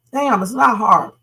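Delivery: ordinary delivery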